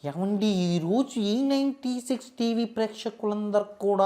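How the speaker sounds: high, slow pious discourse voice; Chaganti impression. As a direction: preach the blessing slowly like a spiritual speaker. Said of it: "Chagandi గారితో start చేద్దాం".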